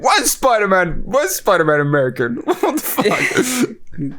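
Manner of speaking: imitating an indignant friend, high and laughing; a hammed-up rant that breaks into laughter; mock pissed off. Teasing a friend with a mock outraged rant. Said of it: "You sounded really pissed off then".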